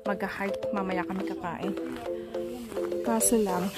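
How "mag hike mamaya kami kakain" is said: usual speaking voice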